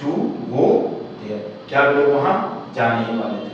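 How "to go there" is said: word by word again, paused; teacher rereading the question; slow reread. Shown as a demonstration. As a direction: read it out once more slowly. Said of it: "फिर से पढ़कर सुना रहा हूं".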